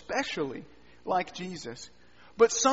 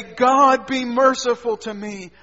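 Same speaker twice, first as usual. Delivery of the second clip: fervent plea; 3 semitones higher, 9 dB louder, slower than usual. Acted. Sinner pleads to God.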